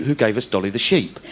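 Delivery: ordinary talk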